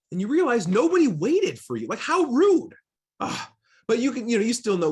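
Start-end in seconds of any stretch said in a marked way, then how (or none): 1.9-2.7 indignant. Acted. Hiker left behind by group.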